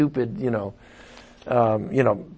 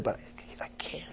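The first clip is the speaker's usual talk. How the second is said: hushed self-reproaching whisper; 24 dB quieter, much faster than usual. Acted. Pastor voices a sinner scolding himself in a whisper. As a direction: breathe it out ashamed under your breath.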